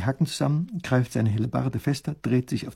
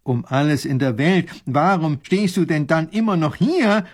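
louder, rising, stretched-out exasperation; exasperated professor; 4 semitones higher, 6 dB louder than usual. A narrator's reading. Professor to the soldier, unable to contain his exasperation. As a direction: burst out in disbelief. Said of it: "kann der Professor nicht mehr an sich halten".

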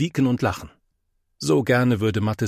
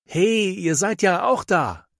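calling out; 9 semitones higher, slower than usual. A narrator's reading. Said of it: "ruft plötzlich jemand".

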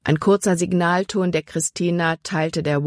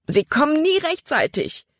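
high, alarmed outcry; horror-filled; 10 semitones higher than usual. A narrator's reading. Caleb watches the rescue boat, horror-filled cry. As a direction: cry out in dread, pitched way up. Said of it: "Calebs Stimme war schreckerfüllt".

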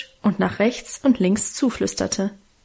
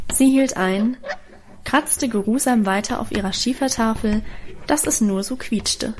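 plain reading voice

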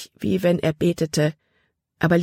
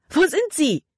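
much higher, frightened cry; alarmed scream; 11 semitones higher than usual. A narrator's reading. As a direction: cry out as both vanish. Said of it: "schrie Libby auf". (erschrockener Aufschrei, viel höher)

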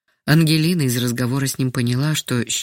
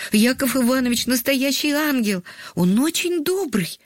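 put-on much higher, childlike, glad voice; delighted boy; 10 semitones higher than usual. A narrator's reading. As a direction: answer as a pleased little boy. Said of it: "обрадовался мальчик". (радостный мальчишеский голос, высоко)

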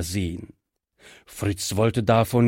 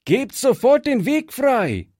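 Fritz calls out; 11 semitones higher than usual. A narrator's reading. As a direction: order them out of the way. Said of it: "rief Fritz".

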